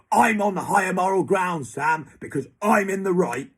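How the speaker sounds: loud and high, self-righteous; smug loud claim. Retold or imitated. Mocking Adam claiming the moral high ground, loud. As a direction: announce it like you've won the argument. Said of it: "if you could say".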